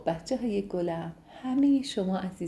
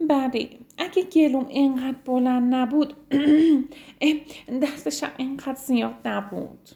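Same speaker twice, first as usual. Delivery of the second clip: higher, sad little giraffe voice with a throat clear; sore-throated giraffe; 5 semitones higher, 4 dB louder than usual. Acted. Zizi the giraffe answering the rhino, sad and sore-throated. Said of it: "ناراحت و گرفته بود".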